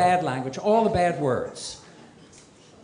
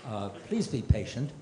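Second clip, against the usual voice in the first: lower, flat recorded-message voice; automated voice; 5 semitones lower, 10 dB quieter than usual. Retold or imitated. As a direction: read it like a phone menu. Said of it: "the automated voice attendant".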